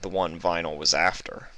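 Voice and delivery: plain reading voice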